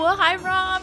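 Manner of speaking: usual speaking voice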